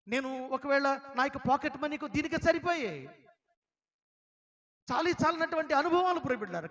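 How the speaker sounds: loud, high preaching voice, pressing the story home; preaching at full force. Acted. Preacher telling the congregation of his tears, in a loud, high voice. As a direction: deliver it like a fiery preacher.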